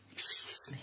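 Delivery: hushed excuse me; asking the ranger quietly, embarrassed whisper. Retold or imitated. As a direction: whisper it discreetly.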